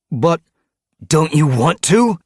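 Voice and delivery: high, loud, unsettled voice; alarmed question. Acted. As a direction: question the order nervously. Underling stunned by kill order.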